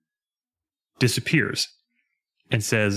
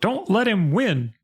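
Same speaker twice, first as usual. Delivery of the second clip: mock yell; 8 semitones higher, slower than usual. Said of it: "the sound of the king yell".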